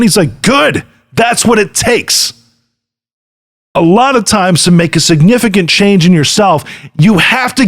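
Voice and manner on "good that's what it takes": high, slow shouted approval. Retold or imitated. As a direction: bark the approval like a tough coach. Andy's blunt approval, retold.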